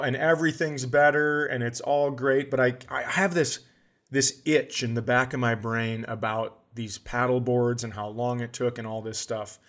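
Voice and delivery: normal talking voice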